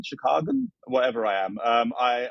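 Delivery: ordinary talk, nothing marked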